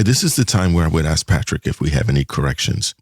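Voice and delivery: ordinary talk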